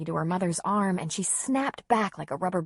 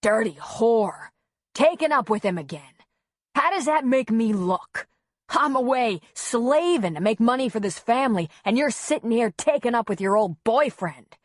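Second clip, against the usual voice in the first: loud, raised jealous tirade; angry ranting; 4 semitones higher, 5 dB louder than usual. A narrator's reading. Husband accusing wife of an affair, furious rant. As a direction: rant accusingly, hitting words hard. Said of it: "nearly drowned Griff's ranting".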